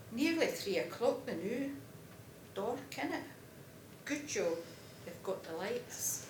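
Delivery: put-on light Glaswegian accent in a lively voice. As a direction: have a go at a Glasgow lad chatting on the way to the game. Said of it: "I'm not Glaswegian, so I'll do my best with this".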